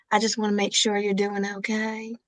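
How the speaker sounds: a soft, slow mock Southern drawl, lightly put on; gentle mock deep South accent; slow deep South impression. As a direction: coo it like a sweet deep South grandma. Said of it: "do that deep sa-, you, deep South, just slow".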